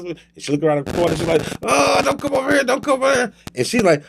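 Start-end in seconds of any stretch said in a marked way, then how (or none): 1.6-3.3 very high, comic pleading squeal; mock-terrified falsetto plea; panicked pleading. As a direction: beg them away in a tiny high voice. Hiding girl pleads as danger nears.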